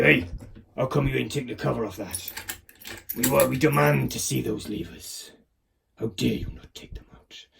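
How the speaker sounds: lightly voiced indignant lever police; mock-official demand, lightly put on. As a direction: play a mildly outraged inspector. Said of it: "I don't know why I speak like this when I talk about the lever police".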